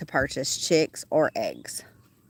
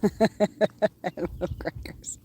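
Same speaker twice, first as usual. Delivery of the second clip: breathy whispered words with a laugh; 3 semitones higher, much slower than usual. Real, not acted. Farmer whispering to her animal about crackers, hushed.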